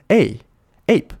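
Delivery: APE with a slow long AY sound. Shown as a demonstration. Teacher correcting learners, slow long-A APE. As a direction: draw out A, then APE with the same AY. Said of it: "你念A".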